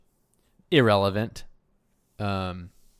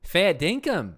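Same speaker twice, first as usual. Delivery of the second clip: higher-pitched, put-on Australian accent; 7 semitones higher, 3 dB louder, slower than usual. Shown as a demonstration. Showing off Australian slang to a friend, hammed-up Australian accent. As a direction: throw out Aussie slang in a broad accent. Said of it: "Can you do an Australian accent?".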